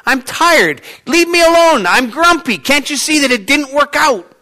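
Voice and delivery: high, loud grumpy fisherman complaining; grumpy Peter. Retold or imitated. Peter grumbling at Jesus, high and loud complaint. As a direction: whine it out like a tired, fed-up fisherman. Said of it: "I'm looking at this guy and I'm going".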